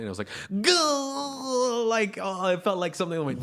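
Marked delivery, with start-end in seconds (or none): 0.6-1.8 long, high "gah" of dismay; mock pained groan. Telling a friend how the remark felt, mock pained groan. Acts out his stung reaction.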